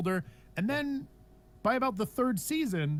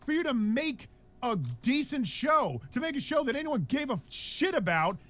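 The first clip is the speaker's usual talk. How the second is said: higher, heated rant; getting mad; 8 semitones higher than usual. Real, not acted. Host vents about the show's padded first season, heated rant. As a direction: let the frustration spill over. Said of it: "I'm gonna get mad for a second".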